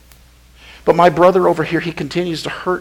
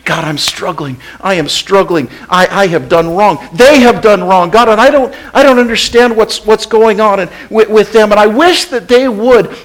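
honest prayer; 7 semitones higher, 8 dB louder than usual. Acted. Believer telling Jesus the plain truth, building louder. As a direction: confess to God, rising as it spills out. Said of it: "tell it to Jesus just the way it is".

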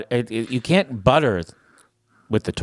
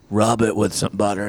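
ordinary talk

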